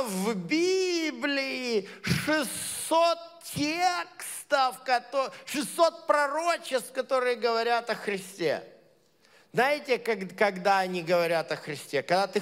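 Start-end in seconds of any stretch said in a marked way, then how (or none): none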